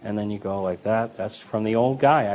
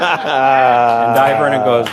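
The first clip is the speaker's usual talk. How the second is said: laughing through words; 10 dB louder, much slower than usual. Real, not acted. Laughing at the story.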